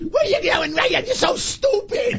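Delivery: very high, slow screechy caricature; mock her in a drawn-out falsetto whine; squeaky, stretched-out scolding voice; Danielle impersonation. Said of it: "Danielle talks like this".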